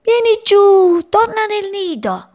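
high, loud call of a worried mother bird. Acted. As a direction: shout him down from the chimney. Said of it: "gli gridò".

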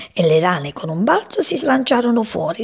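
usual speaking voice

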